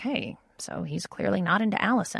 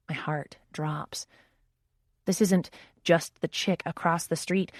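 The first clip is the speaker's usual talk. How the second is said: ordinary delivery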